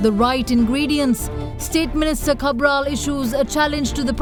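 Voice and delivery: punchy headline read over music; news headlines. Acted.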